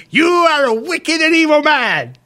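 high, slow, ranting condemnation; slow, lofty mock preacher denouncing a passer-by; preacher's outraged cry. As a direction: bellow it like a street preacher damning a sinner. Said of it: "he went".

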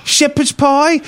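very high, drawn-out imitation; mock-delighted condescending old man; patronising delight.

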